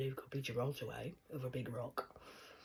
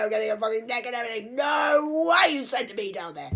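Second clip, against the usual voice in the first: loud, higher, tearful yelling; crying shout; 6 semitones higher, 11 dB louder than usual. Real, not acted. Refusing to be sent down, crying shout. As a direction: shout through rising tears.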